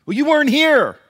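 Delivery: loud, high whiny excuse; defensive retort. Acted. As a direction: throw back the blame. Saul's retort to Samuel.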